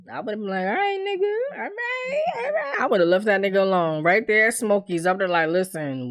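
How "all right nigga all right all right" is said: mock hurt high voice. Imagined reply to a snapping man.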